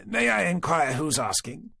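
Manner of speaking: put-on a lightly done clipped upper-class British accent; a hint of a clipped British lord; old lord answering a stranger, light clipped British accent. A narrator's reading. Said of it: "with a distinctly clipped British accent".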